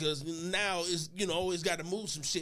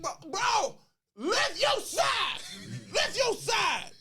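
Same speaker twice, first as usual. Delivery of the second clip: strained shout, straining under weight; frustrated yell; 6 semitones higher, much slower than usual. Retold or imitated. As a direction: yell at your partner to lift. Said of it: "I'm like".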